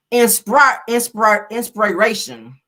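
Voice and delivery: loud, sounding out "inspire"; mock misspelling. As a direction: spell it out mockingly. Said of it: "She done spelled ins".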